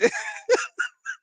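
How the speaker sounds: squealing high-pitched laugh; shrieking laugh near tears; high cackle. Real, not acted. Wife reading funny comment, squealing laugh.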